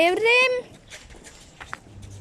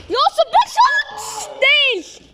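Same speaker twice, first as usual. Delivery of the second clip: screaming football commentator; 10 semitones higher, 3 dB louder, slower than usual. Acted. Shot in commentary.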